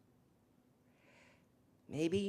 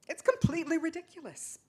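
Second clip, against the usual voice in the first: exasperated; 10 semitones higher, 6 dB louder, faster than usual. Acted. Exasperated outburst in argument.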